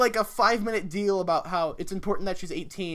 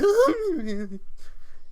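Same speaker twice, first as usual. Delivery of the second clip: much higher, louder unhinged cackle; crazy laugh; 13 semitones higher, 7 dB louder than usual. Retold or imitated. Mocking the YouTuber's character, a loud high crazy laugh. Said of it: "He sounds so weird. He's like".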